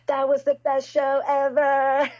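high, loud, slow gushing praise. Retold or imitated. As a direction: gush like a thrilled fan of the show. [name] praising, voiced loudly.

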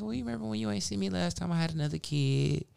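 put-on high girly voice; imitating her. Caller telling Mike about her kid, high imitation. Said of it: "She's like".

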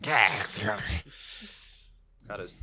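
booming distorted monster voice; loud distorted creature taunt. Acted. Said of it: "it's my monster voice".

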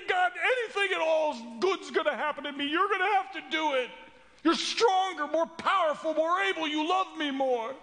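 high, desperate cry for help; breakdown. Acted. Man shouting to God as he breaks down, desperate high outburst. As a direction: snap and shout it out, pitch way up. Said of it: "I snap. I break down".

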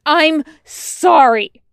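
slow, high, very loud apology; yelling. A narrator's reading. Wash's words come pouring out at Tucker, yelling. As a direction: yell it out, each word stretched. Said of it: "Wash yells again".

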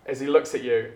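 ordinary delivery

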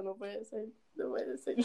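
soft, disbelieving self-talk; muttered dismay. Retold or imitated. Girl realizing her crush saw her, hushed disbelief. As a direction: repeat it quietly to yourself. Retold dismay at being seen.

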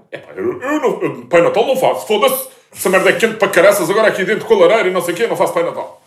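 loud, high grumbling refusal; mock outraged father complaining about the heat; imitating his father. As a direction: play the father flatly refusing to be Santa. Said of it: "O meu pai".

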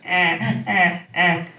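short, high repeated mock whimpers; imitated baby fussing. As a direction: fuss like a baby in little bursts. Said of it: "she would just never shut up".

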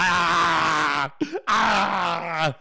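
hammed-up breathy moans of enthusiasm; mock eager moaning. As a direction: moan like an overeager lover. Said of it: "He's like".

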